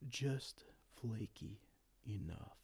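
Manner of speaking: slow, breathy villain whisper; sly whisper. Acted. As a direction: savour the words in a hush. Sinister praise of the dry pie.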